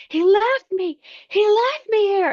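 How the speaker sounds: high, loud wail of abandonment; hysterical scream. Retold or imitated. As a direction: shriek it out, beside yourself. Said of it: "And I'm screaming".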